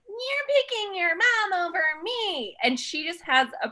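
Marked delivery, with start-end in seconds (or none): 0.1-2.6 high, drawn-out petulant whine; whiny princess. Retold or imitated. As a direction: whine it slowly like a spoiled princess. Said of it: "is more of a princess and is like".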